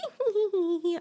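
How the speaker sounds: high, sneaky tittering; mischievous giggle. Retold or imitated. After a sneak diss under their breath, high giggle.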